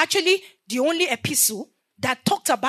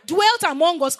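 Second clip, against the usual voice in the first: usual speaking voice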